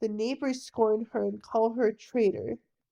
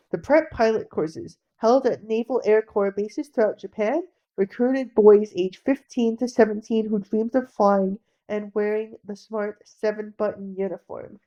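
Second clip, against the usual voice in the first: loud, steady documentary voice-over; loud narration; 9 dB louder than usual. A narrator's reading. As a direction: announce the history firmly.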